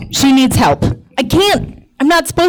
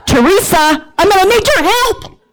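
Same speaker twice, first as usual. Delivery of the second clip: calling out; 8 semitones higher than usual. Retold or imitated. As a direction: call out to Teresa, pitched high. Calling out for help.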